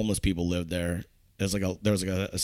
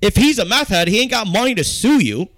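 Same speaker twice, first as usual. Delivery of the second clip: heated; 11 semitones higher, 7 dB louder than usual. Real, not acted. Heated podcast argument.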